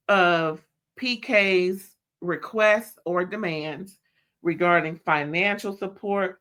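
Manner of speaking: ordinary talk, nothing marked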